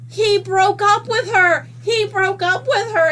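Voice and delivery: high, loud mock wail; crying wail. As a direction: retell it as a distraught, high-pitched cry. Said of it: "I'm crying".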